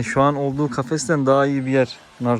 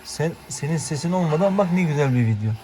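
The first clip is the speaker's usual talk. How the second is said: ordinary talk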